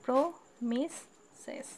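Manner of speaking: "Promises" as pro, mi, ses with clear pauses; three-syllable split. Shown as a demonstration. Teacher counting three syllables for students, "promises" broken into pro, mi, ses. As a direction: say "promises" very slowly, one syllable at a time. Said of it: "এখানে তিনটা আছে".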